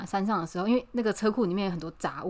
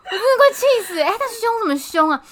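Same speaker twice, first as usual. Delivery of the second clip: exasperated outburst; 12 semitones higher, 7 dB louder than usual. Real, not acted.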